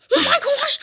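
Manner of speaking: high, squealed alarm; play-acted alarm. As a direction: squeal it in mock panic. Alarmed by the zombies.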